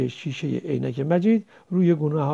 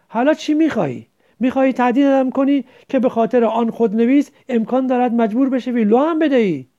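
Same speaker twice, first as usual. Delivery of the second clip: higher and louder, bitter; angry, disgusted question; 5 semitones higher, 6 dB louder than usual. A narrator's reading. Qasem asks Majid, angry and disgusted. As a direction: demand it with contempt. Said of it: "عصبی و منزجر پرسید".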